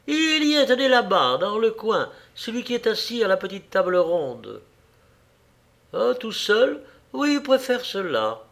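high, lifted voice of a coy hostess; simpering landlady. A narrator's reading. Landlady pointing out a guest to a gentleman, simpering. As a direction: point someone out eagerly, in a high voice. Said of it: "La tavernière parcourut la salle du regard et la bouche en cœur".

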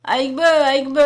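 loud calling out; shout. Acted. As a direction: call the name out across the snow. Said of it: "cria-t-il".

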